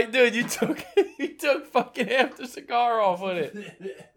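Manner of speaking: talking through laughter; high, laughing while talking. Real, not acted. Friend bites off cigar end.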